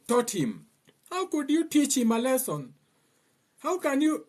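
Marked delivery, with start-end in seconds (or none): 1.1-4.3 mock women's voice. Mocking women again.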